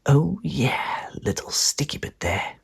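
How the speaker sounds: breathy secret whisper. Acted. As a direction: whisper it so nobody wakes. Secret night cake eating.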